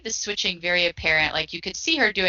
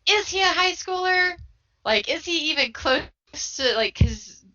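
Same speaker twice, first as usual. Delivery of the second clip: incredulous; 10 semitones higher than usual. Real, not acted. Incredulous about the character's age.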